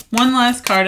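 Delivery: usual speaking voice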